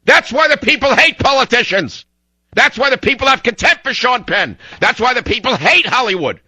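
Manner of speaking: high-pitched, furious ranting shout; angry yelling. Real, not acted. Radio host railing at politicians and Hollywood, enraged yelling. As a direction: yell it as a real outburst, each line hammered.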